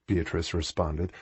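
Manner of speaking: plain reading voice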